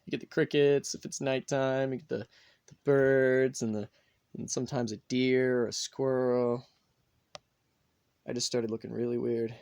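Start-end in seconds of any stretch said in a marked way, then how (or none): none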